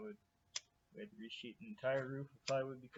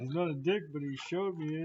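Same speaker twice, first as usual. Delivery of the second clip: loud teasing repeat, higher; parroting a friend's line, loud and high; mocking echo; 4 semitones higher, 8 dB louder than usual. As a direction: throw his words back at him, louder.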